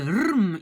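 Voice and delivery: "rm" held to show both consonants; drawn-out consonant cluster. Shown as a demonstration. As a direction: sound out "rm" as one long cluster. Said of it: "il n'y a pas juste une consonne". (rm, deux consonnes bien prolongées)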